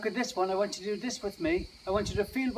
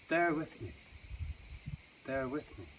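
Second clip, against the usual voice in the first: fading away; 4 semitones lower, 8 dB quieter than usual. Acted. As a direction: repeat it lower and quieter, dying away. Repeats the phrase, fading away.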